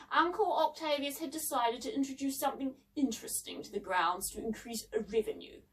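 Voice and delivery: ordinary delivery, nothing marked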